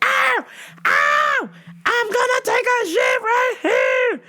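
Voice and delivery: high drawn-out shrieked threat; mock yelling. Lady threatening the boss at the door, mock yelling. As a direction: holler it as the outrageous customer. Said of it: "She was yelling".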